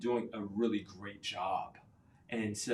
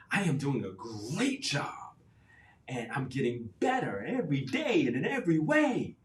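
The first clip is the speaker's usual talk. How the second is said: louder, higher, with a laugh; emotional affirmation; 3 semitones higher, 5 dB louder than usual. Shown as a demonstration. Coach urging caregivers to feel it, excited. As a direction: put your whole body into it. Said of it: "put your emotion into it".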